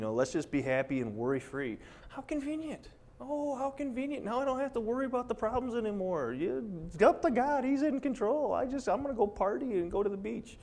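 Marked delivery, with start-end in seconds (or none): 2.1-10.6 high, mocking singsong of a carefree believer; sarcastic mockery. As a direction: sneer it out way up high like a careless optimist.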